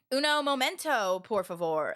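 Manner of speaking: extra-Americanized Southern Spanish, dropping low at the end; mimicked Southern American accent in Spanish. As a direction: imitate a Southerner attempting Spanish. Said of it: "'cause she's Southern".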